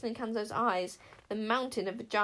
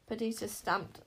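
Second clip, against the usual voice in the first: ordinary delivery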